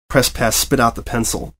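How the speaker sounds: ordinary delivery, nothing marked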